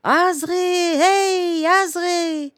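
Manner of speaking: high, long-drawn holler; full-force calling shout. A narrator's reading. Haïdouk hailing the old woman from the heights, full-force shout. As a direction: call out loudly across a valley. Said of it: "Un haïdouk cria de toutes ses forces".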